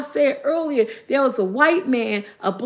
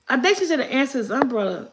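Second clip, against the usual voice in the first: normal talking voice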